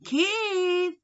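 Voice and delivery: high, called across to the next room; calling out. A narrator's reading. Mother calling son from next room, high call. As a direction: call the boy from another room. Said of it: "his mother called from the next room".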